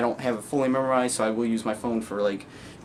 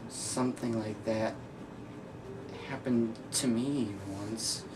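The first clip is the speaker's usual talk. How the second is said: hushed, halting recollection; Joker; 9 dB quieter than usual. Acted. Joker unsure of his own past, quiet and haunted. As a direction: sink to a soft murmur with long pauses.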